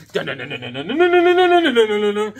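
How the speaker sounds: "dun-dun-dun" climbing to very high and loud; imitated bionic sound effect. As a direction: build a rapid-fire mouth-made TV sound effect. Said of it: "They have the sound effect like".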